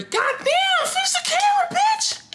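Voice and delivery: very high-pitched squawk; exasperated outburst. Real, not acted. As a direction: yell it high, like a sudden comic tantrum. Sudden outburst at the camera.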